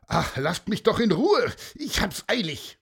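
brusque, high-pitched shout; irritated call while riding away; cyclist calling back. Acted. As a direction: brush them off in a hurry. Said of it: "der rief nur".

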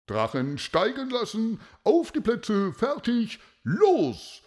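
high-pitched starting call, stretched out for the crowd; loudspeaker announcer. A narrator's reading. Announcer starts the kite contest for the crowd, announcer voice. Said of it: "hörte man die Stimme aus dem Lautsprecher".

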